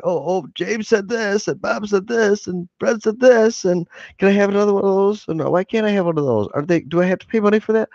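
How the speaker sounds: a high, rambling echo of the chat that speeds up at the end; Miles impression. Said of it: "mentions pretty much everything everybody's saying".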